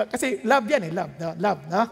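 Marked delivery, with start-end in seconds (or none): none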